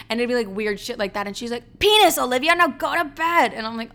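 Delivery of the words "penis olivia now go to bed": high, loud fed-up command; exasperated mom. Retold or imitated. Mom giving in and ordering bed, exasperated shout. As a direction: shout it like a parent at the limit.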